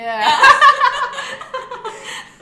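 a big burst of high laughter; loud laugh. Real, not acted. Friend cracks up at her joke, loud high laugh.

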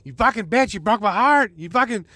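hammed-up pretend scream of heartbreak; imagined angry scream. Imagining yelling at the ex-girlfriend who dumped him, mock angry yell. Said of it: "Just fucking scream at her".

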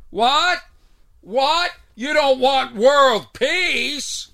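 high, drawn-out hammy yell; mock yelling. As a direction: yell it across a stage, overacting. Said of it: "and then yelling this tired material".

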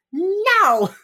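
high, very loud retold wail; upset yell. [name] retold snapping at her boss, upset yell. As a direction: yell a hammed-up, upset refusal. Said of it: "so upset".

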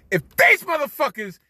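yelling at the TV in disbelief; outraged yell. Retold or imitated. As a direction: shout it high and loud, furious at the refs. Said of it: "and I'm like".

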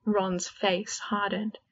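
usual speaking voice

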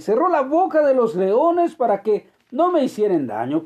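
loud, high, slow solemn reply; Daniel answers. Acted. Daniel answering the king from the lions' den, loud and solemn.

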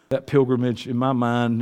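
ordinary talk

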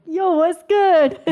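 high, loud, drawn-out mimicry; imitated boyfriend greeting. Imitating his call in front of her brother, loud and exaggerated. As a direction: blast out his hyped greeting slowly. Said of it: "and you were like".

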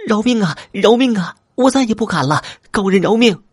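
very high-pitched frantic begging; crying out for mercy. A narrator's reading. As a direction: plead for your life in a high squeal. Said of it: "大声呼救".

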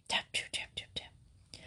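unvoiced, teasing tap tap whispered; mock the girls' whispering with breathy taps; cross whisper. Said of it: "Mister Crackle said crossly".

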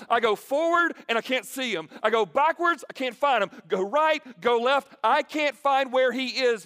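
loud, high anguished cry; pleading quote. Retold or imitated. Preacher voicing a man who cannot find God, loud and high. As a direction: cry out the lost believer's complaint. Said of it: "he goes".

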